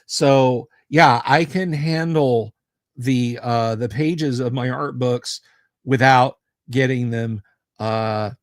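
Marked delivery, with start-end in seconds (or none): none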